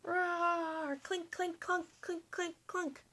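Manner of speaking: high roar then clinking metal; high playful battle noises; roar and clanking. Acted. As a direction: voice the clash. Battle sound effects.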